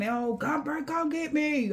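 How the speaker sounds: lightly voiced helpless person calling out; mock damsel calling. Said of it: "this the type of person".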